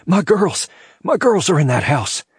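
high, anguished cry; panicked shout. A narrator's reading. Man shouts to his brother about the burning house, panicked. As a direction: shout it in raw panic for loved ones. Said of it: "his face ravaged in panic, and shouted".

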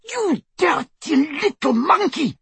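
high, drawn-out angry bawl; furious bawl. A narrator's reading. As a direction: bawl the insult at the elf. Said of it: "bawled Bellatrix".

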